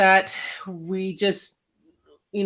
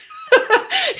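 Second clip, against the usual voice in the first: high laugh; 6 dB louder than usual. Real, not acted. Laughs at own list.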